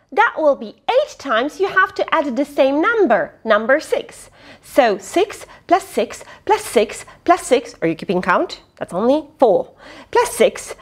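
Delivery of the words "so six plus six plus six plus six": ordinary delivery, nothing marked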